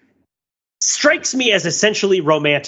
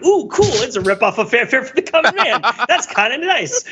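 high, bright-eyed mock delight; delighted kid; 7 semitones higher than usual. Young fan to scoffing dad, imitating high delight. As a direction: gush like a thrilled young fan. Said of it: "And I was like".

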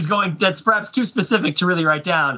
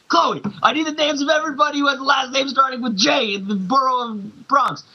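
high-pitched, hammed-up agent giving orders; action-hero urgency; 8 semitones higher than usual. Field agent ordering his analyst, mock urgent. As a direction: demand it like an agent against the clock.

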